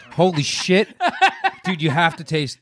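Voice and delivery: laughing exclamation at bad taste. Real, not acted. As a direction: laugh it out in disbelief. Reacting to awful whiskey.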